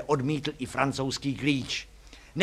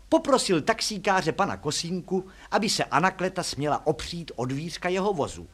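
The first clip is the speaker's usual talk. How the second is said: plain reading voice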